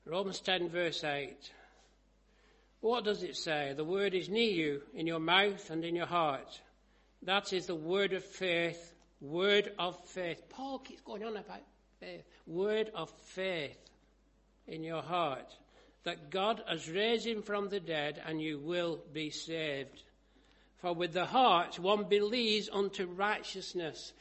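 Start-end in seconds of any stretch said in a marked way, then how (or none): 0.0-10.5 loud, slowing preacher's proclamation; scripture reading. Acted. Preacher reading Romans to the congregation, loud declamation. 12.5-24.1 loud, slowing preacher's proclamation; scripture reading. Acted. Preacher reading Romans to the congregation, loud declamation.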